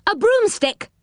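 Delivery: high-pitched call, falling at the end; loud reply. Acted. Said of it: "said Moonface loudly".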